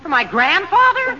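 high, incredulous question; scandalised comic query. Acted. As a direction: echo it back in disbelief, pitch leaping on grandfather. Shocked echo at nightgown suggestion.

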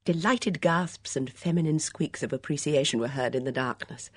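ordinary delivery, nothing marked

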